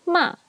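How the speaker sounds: short "ma" dropping from high; falling fourth tone. Shown as a demonstration. Teacher recapping fourth tone, "ma" falling. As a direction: drop "ma" sharply. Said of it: "Fourth tone".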